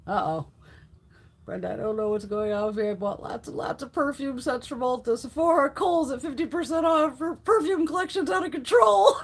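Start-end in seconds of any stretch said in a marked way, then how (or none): 1.4-9.2 rising higher and louder as the whining builds; hammed-up husband grumbling, climbing in pitch; mocking husband's complaint. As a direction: poke fun at him griping to Brenda about her perfume haul. Mock voicing husband complaining about perfume.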